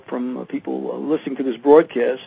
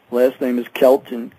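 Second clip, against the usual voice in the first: usual speaking voice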